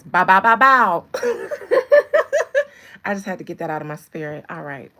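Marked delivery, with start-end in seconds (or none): 0.0-1.0 very loud rapper ad-lib; French Montana. Retold or imitated. Fan shows why she likes him, booming rapper imitation. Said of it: "Because he says". 1.1-3.0 high, rapid-fire loud cackle. Real, not acted. Streamer right after her rapper impression, loud high cackle.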